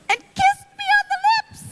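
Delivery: very high, loud fangirl squeal; excited squeal. Real, not acted. Fan telling how her idol kissed her, excited squeal. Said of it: "I was so excited".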